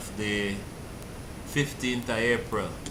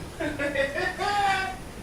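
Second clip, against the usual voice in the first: very high, giggling laugh; helpless laughter; 4 dB quieter than usual. Real, not acted. Host amused by the rant, helpless high laughter.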